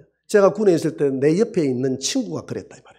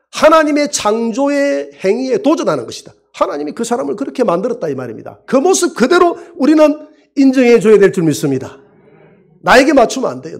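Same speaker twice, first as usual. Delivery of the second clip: higher and louder, fervent sermon; preaching at full force; 7 semitones higher, 6 dB louder than usual. Acted. Pastor urging the church to accept each other, loud preaching. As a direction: drive it home to the congregation.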